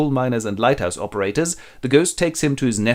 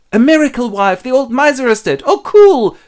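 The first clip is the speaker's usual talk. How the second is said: loud, high elated outburst; overjoyed; 12 semitones higher, 9 dB louder than usual. Acted. Husband tells wife the miser is dead, overjoyed. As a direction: exclaim it jubilantly, rising to a squeal.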